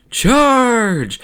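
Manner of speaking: high, loud drawn-out battle scream; screamed charge. Acted. Queen ordering troops into battle, with a hammed-up scream. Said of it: "looks at her troops and screams".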